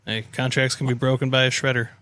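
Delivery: normal talking voice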